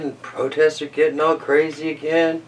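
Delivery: usual speaking voice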